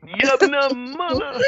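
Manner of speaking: high squeaky creature voice; Ewok voice. Retold or imitated. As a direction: chirp it like a little Ewok. Echoes Ewok line higher.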